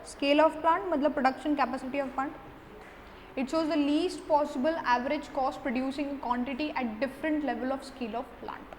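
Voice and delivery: slow, word-by-word dictation pace; slow note dictation. Acted. Teacher dictating notes to students, slow and deliberate. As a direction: spell it out so students can write it down.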